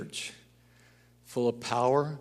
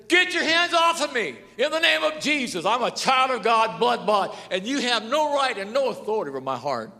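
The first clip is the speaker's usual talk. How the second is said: loud high commanding rebuke; forceful rebuke; 10 semitones higher, 4 dB louder than usual. Retold or imitated. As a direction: order the spirit out of the body. Retelling rebuke of the spirit.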